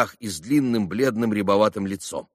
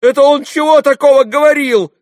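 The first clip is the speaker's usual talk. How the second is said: very high, loud bewildered question; agitated demand; 12 dB louder than usual. A narrator's reading. Lad turning to the traders, agitated and baffled. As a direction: yell for an explanation.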